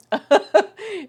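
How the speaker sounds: real giggle at the feather; high burst of laughter. Real, not acted. Big feather.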